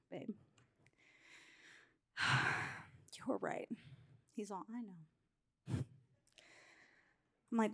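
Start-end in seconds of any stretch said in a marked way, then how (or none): 0.1-3.7 sighing, hushed, low concession; sigh, then murmur it as a reluctant apology; sheepish admission. Retold or imitated. Wife coming back to admit her husband was right, sheepish and hushed. 4.7-5.8 low, very soft imitated husband; meek quiet reply. Peaceable husband accepting his wife's apology, imitated quietly.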